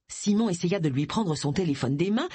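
ordinary delivery

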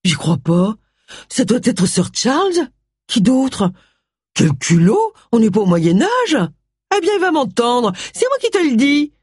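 high, outraged housekeeper voice; a lightly voiced housekeeper, high and indignant. A narrator's reading. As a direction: sputter with outrage at the bell. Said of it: "médusée".

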